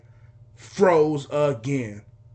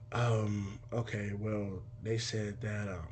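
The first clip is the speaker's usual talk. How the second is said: low, very soft, hesitant; timid mumble; 6 semitones lower, 12 dB quieter, slower than usual. Retold or imitated. Son on phone asking dad for food, timid mumble. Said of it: "speak up".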